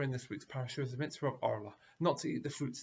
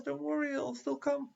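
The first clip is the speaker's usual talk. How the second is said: much higher, carefree reassurance; breezy bus driver; 12 semitones higher than usual. Retold or imitated. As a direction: brush them off cheerfully.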